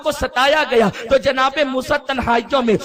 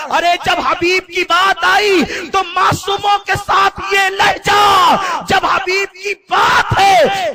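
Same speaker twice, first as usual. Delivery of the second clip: loud, high preaching climax; पुरजोश उपदेश; 6 semitones higher, 4 dB louder, slower than usual. Acted. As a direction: cry it out to the congregation. Preaching climax at full force. (पुरजोश बुलंद तक़रीर)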